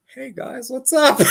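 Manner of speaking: squeaky high "Hey, guys"; delayed high greeting. Retold or imitated. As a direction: pitch way up, laughing greeting. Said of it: "and then you hear".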